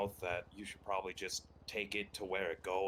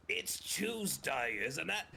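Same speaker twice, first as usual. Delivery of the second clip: slow, higher British "It's Tuesday"; British accent demo; 7 semitones higher, much slower than usual. Shown as a demonstration. Challenging his friend to copy, slow British demo. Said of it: "Can you do that one?".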